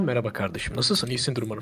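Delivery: low, soft, proper city manners; quiet, measured, careful standard Turkish; polite standard speech. Acted. Greeting someone in normal company, polite standard speech. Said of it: "normal topluluk içindeyken".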